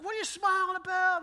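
loud, high mock outrage; retold yelling. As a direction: imitate an exasperated friend yelling. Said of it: "He's, like, yelling at me".

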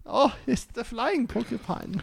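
soft, very high, slow camp German; dainty, hammed-up German voice; camp German accent. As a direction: coo it in a falsetto German accent. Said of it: "they always sound so camp".

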